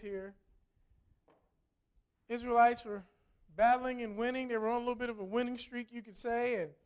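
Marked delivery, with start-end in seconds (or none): none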